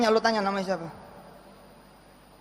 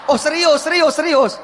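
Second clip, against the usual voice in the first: urgent; 7 semitones higher, 6 dB louder than usual. Real, not acted. Urging the crowd.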